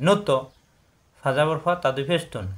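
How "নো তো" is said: নো তো with a bounced ত; qalqalah bounce. Shown as a demonstration. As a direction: push off the ত in নো তো. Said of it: "বাড়ি খাবে".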